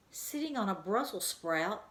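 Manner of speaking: plain reading voice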